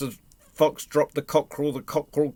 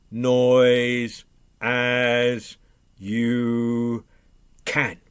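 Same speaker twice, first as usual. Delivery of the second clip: word by word, heavily stretched; slow, spaced-out delivery; drawn-out instruction; 5 dB louder, much slower than usual. Acted. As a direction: stretch each word out with deliberate pauses. Jack's drawn-out instruction to animals.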